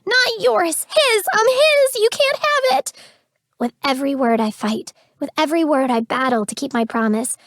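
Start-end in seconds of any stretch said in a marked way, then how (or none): 0.0-2.9 high, voice-breaking scream. A narrator's reading. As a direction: scream it, voice cracking. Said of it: "my voice breaking".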